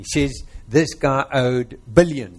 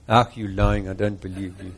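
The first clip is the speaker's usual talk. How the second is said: chuckling, low and playful; laughing disbelief at the crowd; teasing laugh; 8 semitones lower than usual. Real, not acted. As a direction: tease them through a laugh. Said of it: "teasing congregation with laughter".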